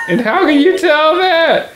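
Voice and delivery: high, incredulous demand; indignant disbelief. Acted. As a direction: challenge the claim. Indignant challenge.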